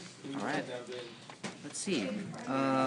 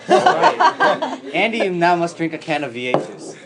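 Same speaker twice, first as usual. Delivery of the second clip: laughing; 5 semitones higher, 7 dB louder than usual. Real, not acted. Laughs announcing gross penalty.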